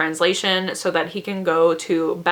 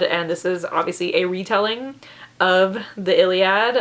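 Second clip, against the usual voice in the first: ordinary talk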